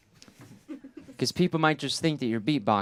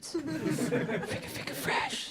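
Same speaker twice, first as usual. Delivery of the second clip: beatbox sounds; 9 dB quieter, much slower than usual. Pretend. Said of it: "a fresh beat".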